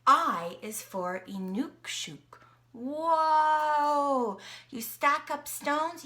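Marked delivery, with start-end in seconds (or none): 2.7-4.4 long, loud drawn-out whoa; be blown away by the picture; big gasp of wonder for the kids; amazed whoa. Acted.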